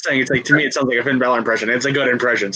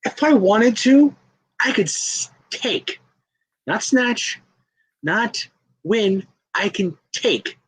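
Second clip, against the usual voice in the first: higher-pitched, halting taunt; menacing promo; 6 semitones higher than usual. Acted. Wrestler threatening to take the champion's precious title, taunting. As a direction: toy with the rival in pieces, pausing between phrases.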